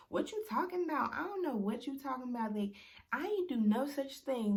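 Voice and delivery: imitating the girlfriend denying it in a high voice; mock innocent denial. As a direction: brush it off like she has no idea.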